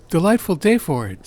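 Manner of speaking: high, loud hearty hello; cheery greeting. Acted. Fen greeting his friend by the fireplace, high and hearty.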